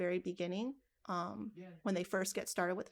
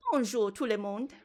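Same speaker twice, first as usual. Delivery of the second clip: louder, playful French-accented greeting; 5 dB louder than usual. Shown as a demonstration. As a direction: show off your best French accent. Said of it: "how good my French accent is".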